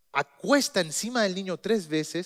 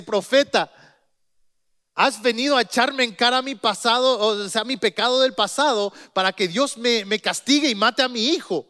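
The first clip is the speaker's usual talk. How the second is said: higher, louder anguished blame; grieving accusation; 5 semitones higher, 4 dB louder than usual. Acted. Mother blames the prophet for her dead son, anguished and loud. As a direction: hurl it at the prophet in grief.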